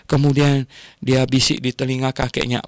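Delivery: ordinary delivery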